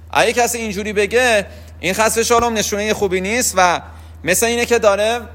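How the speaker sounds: usual speaking voice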